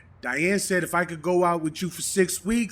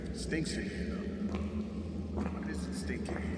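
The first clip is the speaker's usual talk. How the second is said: uneasy whisper; 6 semitones lower, 9 dB quieter, slower than usual. Acted. Ex in cave, uneasy.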